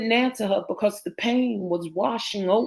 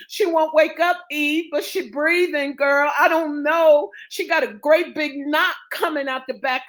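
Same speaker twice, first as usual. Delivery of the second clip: higher-pitched, anxious report; worried woman; 6 semitones higher than usual. Acted. Woman telling Eve how badly Naomi is hurt, anxious. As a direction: tell the bad news in worried, raised pitch.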